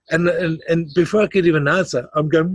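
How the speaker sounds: ordinary delivery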